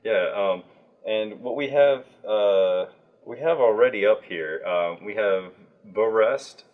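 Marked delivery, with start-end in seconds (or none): none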